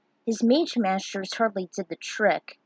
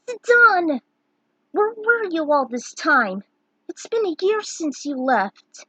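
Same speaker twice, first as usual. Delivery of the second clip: high, loud cry of his name; excited call; 7 semitones higher, 5 dB louder than usual. Acted. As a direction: shout his name in shocked joy. Reunion, calling out to Zidane.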